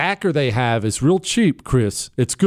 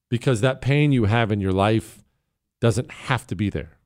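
ordinary delivery

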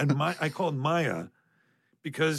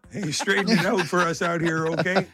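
put-on louder, higher folksy Midwest accent; 5 semitones higher, 3 dB louder than usual. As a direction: play a folksy Midwest local. Joins in the hick voice bit.

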